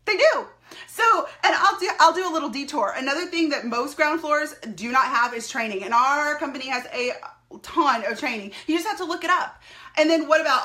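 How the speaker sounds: ordinary talk, nothing marked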